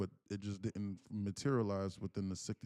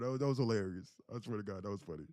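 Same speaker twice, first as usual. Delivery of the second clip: ordinary talk, nothing marked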